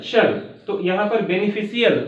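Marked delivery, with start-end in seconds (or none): 1.2-2.1 बेनिफिशियल as beni-fi-si-yal; wrong pronunciation. Shown as a demonstration. Teacher shows class the misreading, mispronounced. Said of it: "कुछ लोग इसको गलत पढ़ते हैं".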